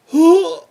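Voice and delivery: high, loud mock gasp of offense; a hammed-up shocked intake of breath; sharp gasp. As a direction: gasp as the slighted wife. Wife's reaction to being ignored.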